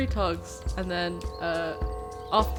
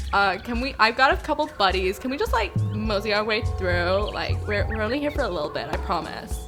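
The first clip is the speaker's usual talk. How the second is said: excited gushing; 3 semitones higher, 4 dB louder than usual. Acted. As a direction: win the snake over excitedly. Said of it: "and be like".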